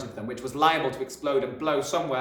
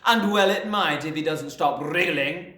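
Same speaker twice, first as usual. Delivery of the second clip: snorting scorn; 5 semitones higher, 6 dB louder than usual. A narrator's reading. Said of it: "snorted the Trunchbull".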